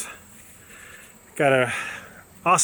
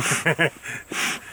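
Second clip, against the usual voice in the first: breathy, nervous little laugh. Real, not acted. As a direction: laugh off the fear of a bee. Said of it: "That's a nervous laugh, my friends".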